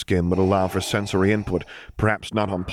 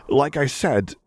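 ordinary delivery

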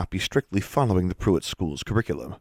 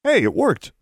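high, surprised exclamation; surprised delight; 13 semitones higher, 4 dB louder than usual. A narrator's reading. Student seeing his spell work, surprised. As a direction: exclaim at an unexpected success. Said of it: "He looked at his wand in surprise".

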